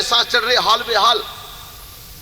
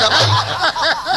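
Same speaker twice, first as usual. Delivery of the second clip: short laugh; 5 semitones higher than usual. Real, not acted. Laughing at grandfather's boast.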